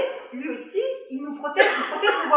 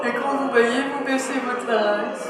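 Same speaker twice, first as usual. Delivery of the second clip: yawning. Shown as a demonstration. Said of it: "vous essayez de parler en baillant".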